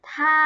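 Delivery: ทา held long on an even tone; model pronunciation. Shown as a demonstration. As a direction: sound out ทา clearly for learners to copy. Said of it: "ออกเสียงตามเหล่าชื่อนะคะ".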